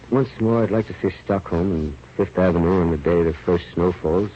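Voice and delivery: ordinary delivery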